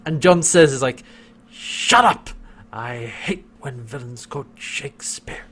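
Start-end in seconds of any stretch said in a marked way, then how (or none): 1.5-5.4 theatrical mock exasperation; over the top. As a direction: imitate a hammy outburst, then sink low. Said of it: "very over the top".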